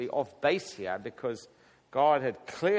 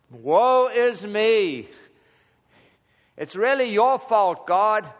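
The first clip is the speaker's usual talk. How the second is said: slow, higher, louder hammed-up lament; mock whining; 6 semitones higher, 7 dB louder, slower than usual. Mocking Joshua blaming God, in a hammed-up wail. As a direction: wail it like a melodramatic martyr.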